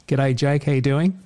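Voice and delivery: ordinary talk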